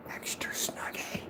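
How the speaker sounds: slow, very soft whisper; cooing whisper. Real, not acted. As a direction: whisper it slowly to a beloved pet. Whispered baby talk to pet.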